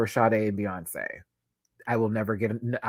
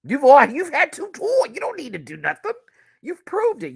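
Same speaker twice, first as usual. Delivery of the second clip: high and loud urging; exasperated plea; 6 dB louder than usual. Real, not acted. Fan telling the couple they've done enough, high and loud. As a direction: plead with them to rest, voice high. Addressing [name] and [name].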